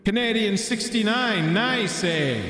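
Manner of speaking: high, hammed-up Canadian accent; mock Canadian accent. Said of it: "Canadians, what would I say".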